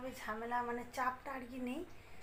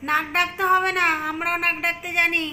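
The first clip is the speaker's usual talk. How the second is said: much louder, higher mock scolding of the cat; loud playful teasing; 4 semitones higher, 14 dB louder than usual. Real, not acted. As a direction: tease the snoring cat loudly. Teasing snoring cat goodnight. (বিড়ালকে জোরে মজার বকুনি)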